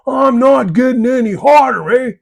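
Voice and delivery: loud, high-pitched mock yell; yelling pot. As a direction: yell in protest as a talking pot. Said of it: "the pot's yelling at you".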